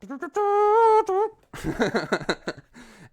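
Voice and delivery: long loud high vocal fanfare, then laughing; Batman-mode sound effect. Retold or imitated. Storyteller acting out going into Batman mode, loud fanfare. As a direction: imitate a heroic launch noise. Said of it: "I'm just like".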